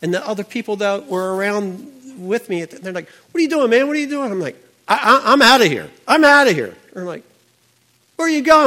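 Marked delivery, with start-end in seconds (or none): 3.1-4.3 high, loud baffled yell at a friend; alarmed shout. Retold or imitated. Friends yelling at him as he jumps up, alarmed. 4.9-6.8 loud, high, urgent blurting; frantic exclaim. Retold or imitated. Him telling his friends he must go, loud and frantic. He bolts from the woods. 8.2-8.7 high, loud shout after someone leaving; calling after. Retold or imitated. Friend calling after him as he leaves, loud and high.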